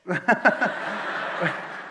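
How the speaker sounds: loud, breathy laugh; real laughter breaking out; burst of laughter. Real, not acted. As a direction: crack up at the punchline. Laughs at the joke.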